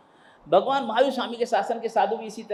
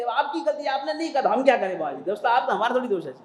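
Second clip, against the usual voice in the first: brazen blaming retort; 5 semitones higher, 5 dB louder than usual. Acted. Disciple blames guru instead.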